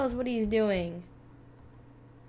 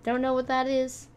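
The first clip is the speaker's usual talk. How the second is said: usual speaking voice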